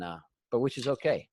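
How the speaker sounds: normal talking voice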